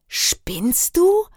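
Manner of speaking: high-pitched, incredulous outrage; indignant outburst. A narrator's reading. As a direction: shoot it back, appalled, voice leaping up. Outraged girl, jumps up at rumour. (empört und schrill hochfahrend)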